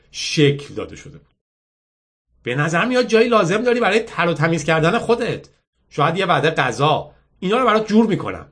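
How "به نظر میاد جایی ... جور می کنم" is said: put-on higher-pitched girl making an offer; persuasive girl. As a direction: coax him with practical help. (دختری متقاعدکننده با صدای زیر)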